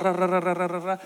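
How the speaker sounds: rapid even run of "ra"; rolling mango sound. Retold or imitated. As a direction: imitate something rolling along a roof. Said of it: "escuchábamos el mango rodar".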